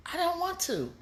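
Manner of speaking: high whiny "I don't want to", dragged out; mock whiny refusal. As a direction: play the sulky lazy person. Mocking a lazy whiner.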